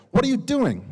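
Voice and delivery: loud, high-pitched mock scolding. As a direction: act out a scolding, pitch jumping right up. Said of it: "one character scolding another character".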